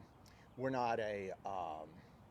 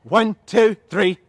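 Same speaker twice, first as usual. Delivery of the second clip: loud, higher rep count; yelled count; 3 semitones higher, 14 dB louder, slower than usual. Real, not acted. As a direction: shout each number out separately. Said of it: "yell so loud".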